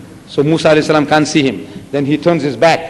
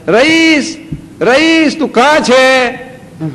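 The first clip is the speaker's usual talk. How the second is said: shouting for grandson; 8 semitones higher, much slower than usual. Retold or imitated. Grandpa pretending to search for his grandson, high playful call. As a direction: call out like you can't find him. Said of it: "So I shout".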